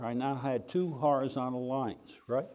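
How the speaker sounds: ordinary delivery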